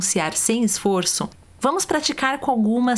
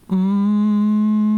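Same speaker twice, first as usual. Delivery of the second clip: long steady hum; meditation hum; 5 dB louder than usual. Shown as a demonstration. Teacher demonstrating the meditation hum to listeners. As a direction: hold a resonant closed-mouth hum. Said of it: "O som é assim".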